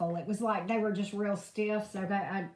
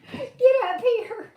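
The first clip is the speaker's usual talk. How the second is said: high, louder and slow get-up call; firm loud call to dog; 12 semitones higher, 8 dB louder, slower than usual. Real, not acted. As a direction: insist the dog climbs up. Calling dog up.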